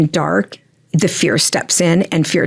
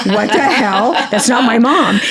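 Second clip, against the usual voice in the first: higher mock disbelief; incredulous client; 5 semitones higher, slower than usual. Medium imitates a client hearing a wrong description, mock disbelief. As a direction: play a lightly voiced baffled daughter. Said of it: "you go".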